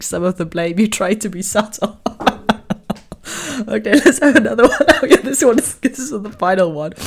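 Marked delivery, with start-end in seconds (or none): 3.7-6.9 laughing, higher and louder. Real, not acted. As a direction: talk through the giggles. Still laughing from the joke.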